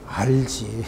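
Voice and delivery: low, soft knowing threat; hint darkly that she knows; quiet menace. Acted. Parrot quietly threatening the woman, low and knowing. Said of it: "조용히".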